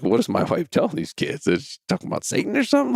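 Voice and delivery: high, incredulous questioning; retold confusion. Dad wondering what wife told kids, baffled and high. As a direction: pitch climbing in baffled disbelief. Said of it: "I'm now confused".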